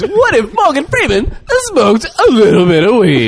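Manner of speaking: high, loopy caricature; silly put-on voice. Pitching the stoned Morgan Freeman idea, high silly voice. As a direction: pitch it way up, goofy. Mocks the weed-smoking impression idea.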